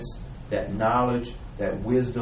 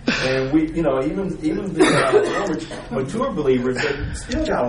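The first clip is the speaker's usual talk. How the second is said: louder, still laughing through the words; 5 semitones higher, 7 dB louder than usual. Real, not acted. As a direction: talk through a lingering laugh.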